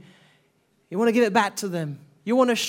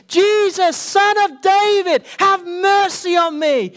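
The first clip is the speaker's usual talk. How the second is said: loud, much higher, slow desperate cry; crying out louder; 10 semitones higher, 8 dB louder, slower than usual. Retold or imitated. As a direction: call out with everything you have. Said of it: "he cried even more the louder".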